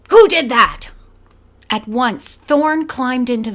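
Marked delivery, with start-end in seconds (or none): 0.1-0.9 furious demand. A narrator's reading. Belle enraged at sabotage.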